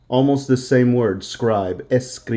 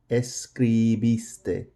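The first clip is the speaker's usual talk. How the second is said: "Escribiste" slow and softer, syllable by syllable; 5 dB quieter, slower than usual. Shown as a demonstration. Teacher models past tense for students, slow syllables of "Escribiste". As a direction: draw out each syllable of "Escribiste". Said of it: "that's gonna be your loudest portion".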